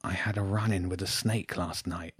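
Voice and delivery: soft, pained murmur; half whisper, half groan it. A narrator's reading. Injured James confiding in Jenny, part whisper, part groan. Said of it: "It was part whisper and part groan".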